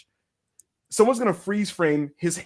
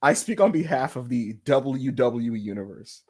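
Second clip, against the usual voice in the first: low, formal proclamation; mock-solemn address; 6 semitones lower than usual. Speaking for all the fans, mock-solemn. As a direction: declare it like a spokesman.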